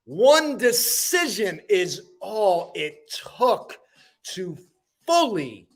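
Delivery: slow, emphatic spoken-word delivery, high attacks falling away; dramatic poem recital. Acted. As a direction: declaim each line, pausing before the last words. Recites own motivational poem.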